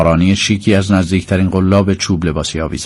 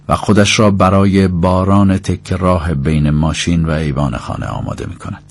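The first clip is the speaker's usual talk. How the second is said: plain reading voice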